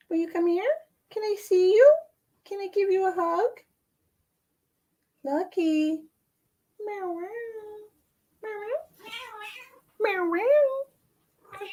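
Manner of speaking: high cooing pet voice. Real, not acted. Greeting and cooing at cats.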